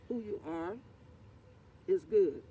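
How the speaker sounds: ordinary delivery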